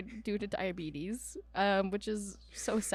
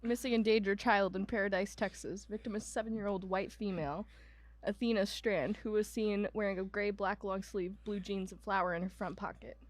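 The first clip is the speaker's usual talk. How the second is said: softer, even read-out voice; reading an alert aloud; 4 dB quieter than usual. Real, not acted. Co-host reading an Amber Alert off to the other, soft read-out. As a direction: read the bulletin quietly and evenly.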